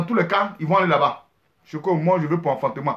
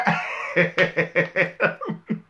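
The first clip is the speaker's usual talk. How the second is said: laughing; 4 semitones lower, 5 dB quieter than usual. Real, not acted. Laughs at own joke.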